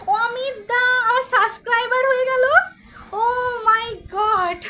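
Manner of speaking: bursting with surprise, stretching the Oh my God out slowly; excited. Real, not acted. Streamer thrilled her friend subscribed, excited.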